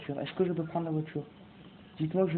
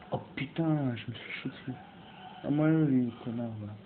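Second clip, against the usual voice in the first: ordinary delivery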